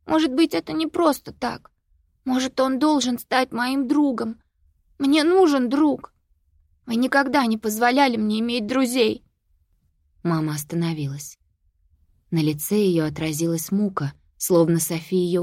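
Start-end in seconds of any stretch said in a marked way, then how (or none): 0.1-9.2 put-on high upset child's voice; tearful girl. A narrator's reading. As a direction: plead through tears. Sophie pleads tearfully.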